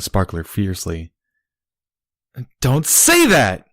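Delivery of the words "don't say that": high, slow, loud plea; shouting. Acted. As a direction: cry it out, holding him tight. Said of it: "Barry shouted".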